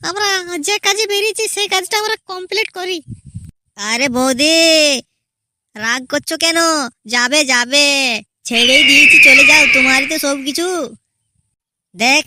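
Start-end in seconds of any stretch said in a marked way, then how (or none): 0.1-3.0 higher-pitched wife voice urging her husband on; a pitched-up wife voice, brushing the goons off; young wife. Acted. 7.1-10.7 louder, huffy send-off; offended outburst. Acted. Goon snaps back, offended.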